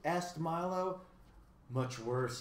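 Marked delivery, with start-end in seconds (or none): none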